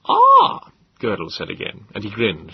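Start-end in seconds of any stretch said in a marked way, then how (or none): 0.1-0.7 much higher, louder; a sly high "Ah"; high grinning exclamation. Acted. Eccentric professor greets the visitor, high and pleased. Gödel character voice.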